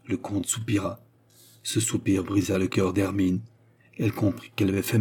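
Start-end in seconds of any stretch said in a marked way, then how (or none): none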